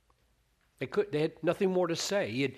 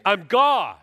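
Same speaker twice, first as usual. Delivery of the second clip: much louder and higher, commanding; booming declaration; 7 semitones higher, 13 dB louder than usual. Retold or imitated. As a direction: proclaim it with divine authority. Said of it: "he will say".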